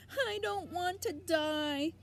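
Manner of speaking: high, slow, loud wail; screamed. Acted. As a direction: throw it out in despair. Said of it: "screamed Wilbur".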